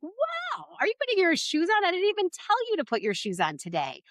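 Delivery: put-on high, bright surprised praise for a child; wow, make it sound amazed; excited praise. Shown as a demonstration. Parent praising a child for putting on shoes, delighted. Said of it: "it's like".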